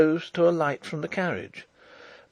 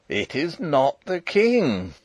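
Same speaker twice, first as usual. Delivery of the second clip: put-on slow, firm old soldier; old marshal; 3 semitones higher, 3 dB louder, slower than usual. A narrator's reading. Old marshal contradicting the princess once more, insistent. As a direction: state it slowly and firmly. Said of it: "said old Sapt again".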